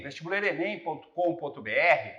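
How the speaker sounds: ordinary delivery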